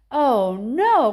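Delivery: loud alarmed cry; mother crying out in alarm at the news; cried out. A narrator's reading. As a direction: gasp it out in horror. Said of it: "cried Missus Teevee".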